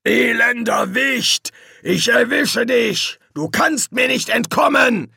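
high, slow, raging demon voice; furious shout. A narrator's reading. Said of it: "Hasturs wütende Stimme".

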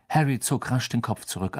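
ordinary delivery, nothing marked

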